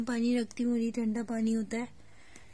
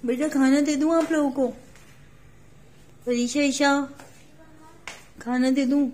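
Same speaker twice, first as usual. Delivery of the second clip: loud call through the house; 3 semitones higher, 5 dB louder than usual. Real, not acted. Mother calls children to eat.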